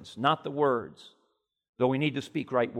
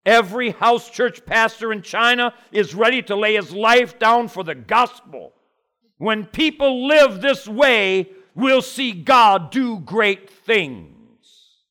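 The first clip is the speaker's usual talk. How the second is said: loud, high and very slow for emphasis; full-force preaching, slowing down; 8 semitones higher, 9 dB louder, slower than usual. Acted. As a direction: drive it home, word by word.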